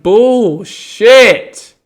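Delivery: retold furious shout of disbelief; angry outburst. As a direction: blurt it out like you can't believe the nerve. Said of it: "And I'm like".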